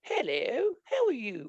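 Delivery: a prim, proper English hello as Americans imagine it; scoffing at Americans' idea of an English accent, mock posh English. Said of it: "got either the".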